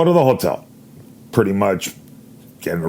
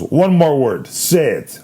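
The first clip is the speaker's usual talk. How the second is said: mock threat; 6 semitones higher, slower than usual. Agent threatening him.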